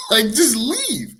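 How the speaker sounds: high, slow, drawn-out plea; retold pleading frustration; exasperated urging. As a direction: stretch it out in mock exasperation. Exasperated thought at the woman.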